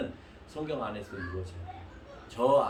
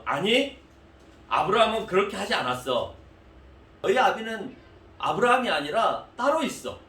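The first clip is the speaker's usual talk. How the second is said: higher, louder, firm correction; firm rebuttal; 5 semitones higher, 5 dB louder than usual. Acted. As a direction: set them straight firmly. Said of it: "예수님께서".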